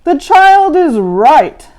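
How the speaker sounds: loud, slow, stern teacher; monster teacher verdict. A narrator's reading. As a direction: deliver the judgment gravely. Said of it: "said Mister Z".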